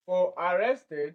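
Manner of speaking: ordinary delivery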